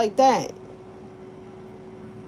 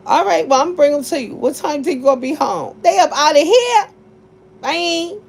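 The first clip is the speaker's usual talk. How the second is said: sassy phone call; 6 semitones higher, 4 dB louder than usual. Retold or imitated. Acting out dropping kids off.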